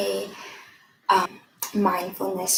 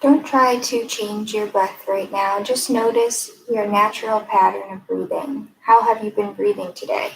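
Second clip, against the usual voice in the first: calm, even guiding voice with long pauses; guided meditation. Acted. Mindfulness fellow leading a breathing exercise, guided meditation. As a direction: lead listeners gently inward, leaving room between phrases.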